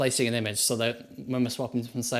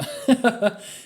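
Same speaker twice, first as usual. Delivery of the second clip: laughing; 10 semitones higher, 7 dB louder than usual. Real, not acted. Brain hurting.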